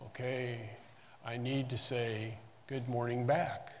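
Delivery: quiet mock self-talk, thinking it through; mock deliberation. Shown as a demonstration. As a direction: murmur as if working out how to reply. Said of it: "we don't stop and say".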